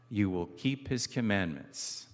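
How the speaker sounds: usual speaking voice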